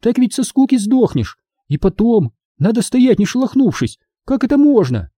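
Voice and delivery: high-pitched appalled protest; high scandalised questioning; indignant. A narrator's reading. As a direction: object high, in disbelief. Minди carries on protesting indignantly.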